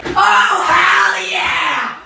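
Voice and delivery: high, loud hyped-up yell; pumped-up shout of joy; hype shout. Acted. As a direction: roar it like the crowd just erupted. Excited intro exclamation.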